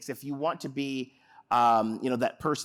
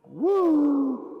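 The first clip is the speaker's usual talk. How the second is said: high held cheer; excited woo; 12 semitones higher, 5 dB louder than usual. Shown as a demonstration.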